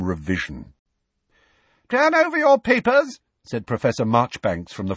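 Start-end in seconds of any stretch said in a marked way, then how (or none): 1.9-3.2 high, commanding examiner's voice; much higher, official call. A narrator's reading. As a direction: announce it to a whole hall. Examiner calls to exam hall.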